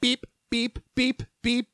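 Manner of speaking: high-pitched mock phone beeps, quick and even; phone beeping. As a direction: chirp like a phone alert going off. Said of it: "my phone starts going".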